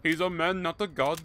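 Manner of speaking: a deliberately bad Indian accent, hammed up. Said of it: "I'm doing a bad Indian accent".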